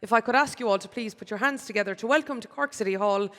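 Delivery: usual speaking voice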